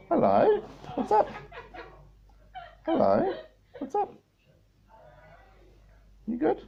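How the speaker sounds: squeaky high hello to the bird. Real, not acted. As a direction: chirp it up high for the pet. High pet greeting.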